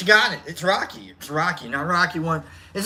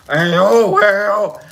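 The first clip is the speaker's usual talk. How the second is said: Rocky voice with dropped lip; 6 dB louder than usual. Pretend. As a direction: drop the lip and push the Rocky voice. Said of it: "I gotta drop the lip".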